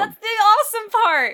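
very high, loud enthusiastic cry; gleeful shouted comeback; excited rebuttal. Real, not acted. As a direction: fire back with loud, high delight. Playful argument defending a loved book.

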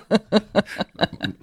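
high, giggly burst of laughter; laughing. Real, not acted. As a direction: crack up at your own story. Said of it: "another laughing emoji".

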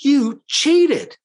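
high-pitched, disbelieving accusation; imitating someone shocked at being cheated; incredulous. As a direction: act out an incredulous protest. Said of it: "looks at him incredulously and he says".

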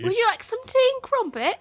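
high-pitched mock-British; good British accent. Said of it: "Fish is pretty good".

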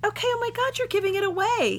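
high-pitched playful protest; mock exasperation. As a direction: complain as if the surprise is ruined. Mock outrage at spoiler.

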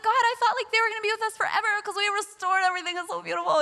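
high, quick, gushing protest; mock protest. Speaker protesting to God about parents leaving, mock protest. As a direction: imitate pleading with God in a rush.

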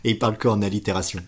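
ordinary talk

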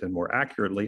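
ordinary delivery

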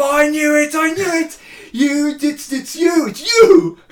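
put-on a kid who has caught dad out; gleeful child yelling it's you; child's excited accusation. Child catching dad mid-switch.

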